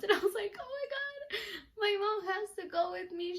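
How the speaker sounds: gush, nearly tearful with excitement; high-pitched fangirl thrill; excited. Real, not acted. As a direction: voice climbing high into an overjoyed squeal. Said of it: "I get so excited".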